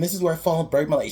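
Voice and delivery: hurried, high-pitched narration of her own fall; mock overselling. Victim announcing her own fall, hammed-up overselling. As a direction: rattle it off like an overacting horror victim. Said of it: "she's just overselling it".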